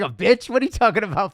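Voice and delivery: loud, high-pitched mock outrage; mock offended comeback. Man answering another man's concern, in loud mock indignation. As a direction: fire back as if insulted, playing it for laughs.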